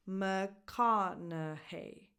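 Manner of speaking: "McConaughey" very slow, stressed on "ka" and "hey"; slow teacher's model, muh-KA-nuh-HEY; syllable-by-syllable stress demo. Shown as a demonstration. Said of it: "The stresses go on ka".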